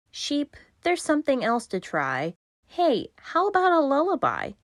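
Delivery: high, coaxing little voice; coaxing child. Acted. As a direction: coax the sheep sweetly.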